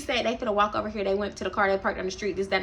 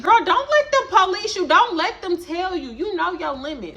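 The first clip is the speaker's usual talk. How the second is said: mock hype-girl sass; 8 semitones higher than usual. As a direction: push her to keep drinking. Darnisha encouraging Maya.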